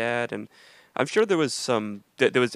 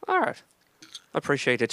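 put-on Irish accent; 4 semitones higher than usual. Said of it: "I do Irish".

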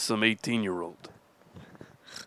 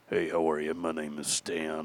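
deep-voiced guy; 7 semitones lower, 4 dB quieter than usual. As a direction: put on a deep older man's voice. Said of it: "they're like this".

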